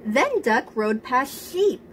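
usual speaking voice

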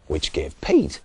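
ordinary delivery

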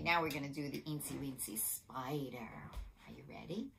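put-on hushed whisper to the children; soft whispered setup for a spider song. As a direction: whisper it like a secret.

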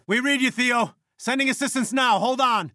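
high, urgent radio call. Acted. Answering Theo and sending assistance, urgent. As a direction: call out help, pitch way up.

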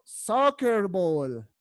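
"Soccer ball" stretched out syllable by syllable; slow model pronunciation. Shown as a demonstration. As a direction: say "Soccer ball" very slowly so they can copy. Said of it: "¿Listo?".

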